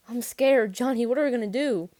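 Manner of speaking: high, loud, frightened cry; panicked screaming. A narrator's reading. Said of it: "I didn't realize I was screaming".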